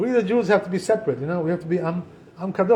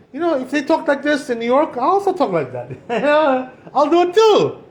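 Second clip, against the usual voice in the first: higher, lightly hammed-up bravado; mock eager copycat; 7 semitones higher, 3 dB louder than usual. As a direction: play someone keen to join in. Voices people copying the cursing.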